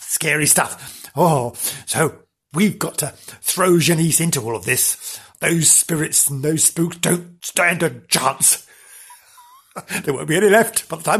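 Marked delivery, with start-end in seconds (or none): none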